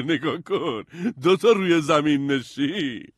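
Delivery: loud, high character voice opening with a laugh; hammed-up excited shout; excited soldiers. A narrator's reading. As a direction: call it out in thrilled amazement, drawing out the last words. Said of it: "هیجان زده گفتن".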